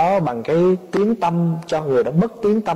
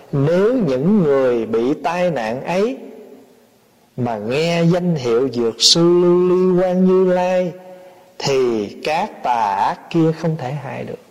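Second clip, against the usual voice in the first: slow recitation, holding the Buddha's name on a long level note; sutra recitation; slower than usual. A narrator's reading. Preacher repeats the sutra verse to followers, slow intoned recitation. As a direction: intone the verse slowly, phrase by phrase.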